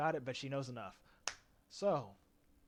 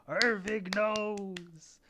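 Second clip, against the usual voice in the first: slow, raised, hammed-up announcement; drawn-out proclamation; 6 semitones higher, much slower than usual. Game master telling players whose characters know, drawn-out and hammed-up. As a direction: stretch every name and 'knows' long and high.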